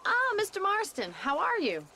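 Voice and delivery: glad greeting. Acted. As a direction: sing out his name in welcome.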